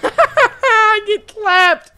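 high, slow words through laughter; cracking up at getting beaten; laughing at his own defeat. Real, not acted. Laughing after getting killed.

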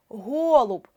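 ГОЛУБ with a long stressed О; наголос на О. Shown as a demonstration. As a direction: stretch the first vowel of ГОЛУБ. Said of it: "якщо ми перевіряємо наголосом". (ГОЛУБ з протяжним наголошеним О)

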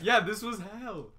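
excited. Real, not acted. Laughing after hot sauce.

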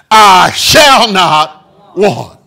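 slow, loud, drawn out "I shall not want"; preacher thundering the verse; proclaiming shout. Shown as a demonstration. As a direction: declare it at full voice. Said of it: "I like to say it like this".

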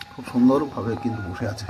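usual speaking voice

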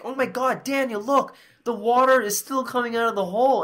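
high-pitched alarmed plea to a friend; acted panic. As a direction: replay calling a friend to look, flustered. Replays own panicked plea to friend.

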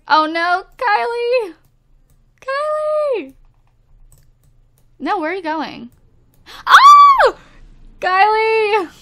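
a genuine high-pitched panic cry; real panicked screaming. Real, not acted. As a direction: scream for Kylie in alarm. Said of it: "what you screaming about, girl?".

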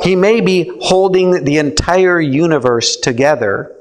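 usual speaking voice

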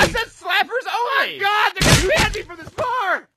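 high, frantic, shouted panic; panicked shouting. Acted. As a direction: shriek it in a panic.

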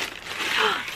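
a sudden, high breathy gasp of delight. Real, not acted. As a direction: gasp as the present comes into view. Opening a present that moves her.

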